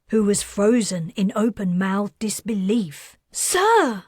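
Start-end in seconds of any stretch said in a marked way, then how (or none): 3.3-4.0 very high, loud warning; trying to stop him; urgent call. Acted. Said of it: "said the flight attendant".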